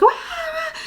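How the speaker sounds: high-pitched mock baby wail; high fake infant cry; imitated baby crying. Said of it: "the baby starts crying".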